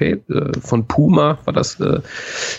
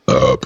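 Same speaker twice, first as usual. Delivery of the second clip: low, loud real burp; 11 semitones lower, 4 dB louder than usual. Real, not acted. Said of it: "Da rülpse ich euch ins Gesicht".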